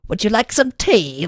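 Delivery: hammed-up British host offering tea; trashy British impression. Neighbor at the door offered tea in a hammed-up British accent. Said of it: "weirdly just a trashy British impression".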